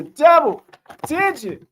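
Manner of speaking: hyped announcer. Acted. Hyping the double-digit scorers.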